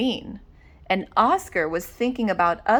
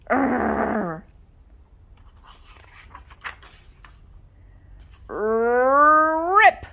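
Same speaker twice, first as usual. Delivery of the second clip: effort grunt then rip; 2 semitones higher, 3 dB louder than usual. Acted. Chomped and tugged.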